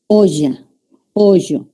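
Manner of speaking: olla and pollo with LL said as Y; yeísmo. Shown as a demonstration. Teacher demonstrating coastal yeísmo. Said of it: "Confundimos la doble L con la Y".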